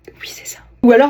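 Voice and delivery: ordinary talk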